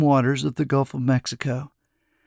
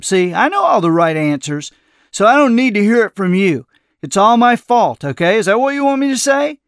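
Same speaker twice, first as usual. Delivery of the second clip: defensive, climbing higher and louder; exasperated outburst; 7 semitones higher, 5 dB louder than usual. A narrator's reading. Narrator taking the blame before the old man, exasperated. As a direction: build to a fast, high-pitched challenge.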